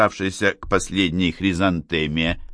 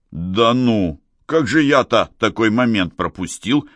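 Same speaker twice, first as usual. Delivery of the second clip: usual speaking voice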